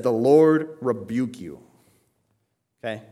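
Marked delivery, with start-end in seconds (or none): 0.0-1.6 louder, slow and weighty rebuke; solemn rebuke. Acted. Archangel Michael rebuking the devil, solemn and slow. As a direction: deliver a slow, forceful quoted rebuke. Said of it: "did not presume to pronounce blasphemous judgment".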